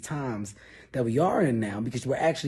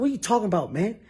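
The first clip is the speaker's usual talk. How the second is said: higher and louder, arguing hard; heated argument; 9 semitones higher, 5 dB louder, much faster than usual. Retold or imitated. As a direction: yell back at a drunk friend. Drunk argument on plane.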